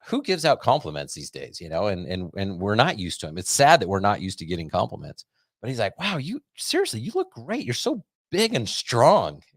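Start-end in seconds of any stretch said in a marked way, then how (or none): 6.0-9.4 much higher mock-gushing praise; imitating the dermatologist flattering his patient, high and gushing; gushing doctor. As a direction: ham up the doctor's over-the-top flattery. Retold doctor piling on praise.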